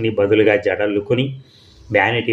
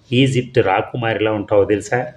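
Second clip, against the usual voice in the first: ordinary delivery, nothing marked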